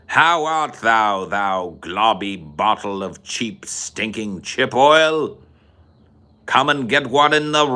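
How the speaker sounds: slow, theatrical British taunt; Clockwork Orange accent. Acted. Taunting Billy Boy in the film, theatrical British. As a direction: relish each insult in a mock British accent. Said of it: "Do a Clockwork Orange accent".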